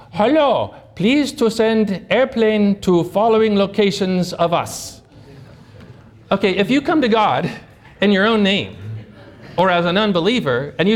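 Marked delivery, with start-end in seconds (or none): 0.1-5.0 put-on Afghan accent, slowly; Taliban accent. Soldier calling headquarters for an airplane, in a Taliban accent. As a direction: imitate a Taliban caller with a heavy accent. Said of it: "it sounds like a Taliban accent".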